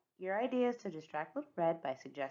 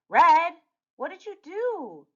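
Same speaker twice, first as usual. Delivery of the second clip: dismayed scolding; 11 semitones higher, 7 dB louder than usual. Acted. Narrator scolds Red over torn cape.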